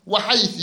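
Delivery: "وحيث" ending in a short i, wa-haythi; kasra ending. Shown as a demonstration. Teacher shows students the third allowed vowel, kasra ending on "وحيث". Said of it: "بالكسر".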